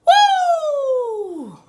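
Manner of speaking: high, loud, whooping cheer; excited woo. Real, not acted. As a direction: whoop with delight. Excited exclamation.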